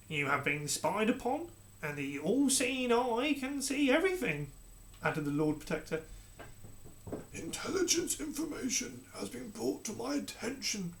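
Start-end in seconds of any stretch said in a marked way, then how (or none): 0.1-4.5 slow, menacing courtier; villainous advisor, slow and ominous; sinister advisor. Acted. Lord Protector accuses the Queen. 7.3-10.9 quiet, distant king; cold, detached monarch. Acted. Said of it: "continued the King".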